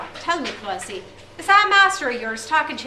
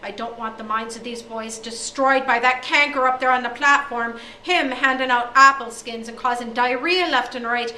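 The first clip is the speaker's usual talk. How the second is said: loud angry rant; 3 semitones higher, 9 dB louder than usual. Acted. O'Day rants at headmaster.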